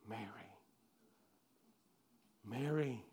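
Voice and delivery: very soft, gentle calling of the name; tender calling. Acted. Jesus calling Mary at the tomb, very soft and tender. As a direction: call her name softly, lovingly.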